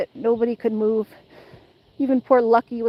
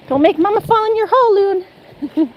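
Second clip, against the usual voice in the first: talking to the dog; 7 semitones higher, 3 dB louder than usual. Real, not acted. Baby talk to dog.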